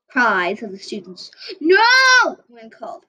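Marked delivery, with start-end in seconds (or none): 1.6-2.4 high, loud panicked no; distressed yell. Acted. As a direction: scream it in fear. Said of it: "distressed yells".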